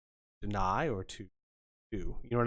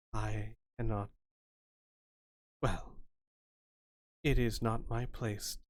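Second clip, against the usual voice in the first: lightly voiced spirit, measured and halting; understated, grave otherworldly advisor; solemn spirit fortune-teller; 3 dB quieter than usual. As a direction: counsel gently with long pauses. GM voices ghostly card reader.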